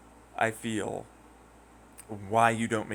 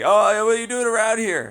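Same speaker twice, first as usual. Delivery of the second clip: high, loud mock challenge; hammed-up threat from a local; menacing local; 12 semitones higher, 10 dB louder than usual. Local confronting a lost tourist, loud mock challenge.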